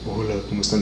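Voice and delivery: a lightly voiced, downcast Ross; sad Ross. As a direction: greet everyone like a gloomy Ross. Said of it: "siempre estaba triste el pendejo".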